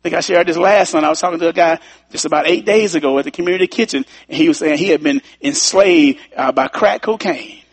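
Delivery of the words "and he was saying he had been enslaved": usual speaking voice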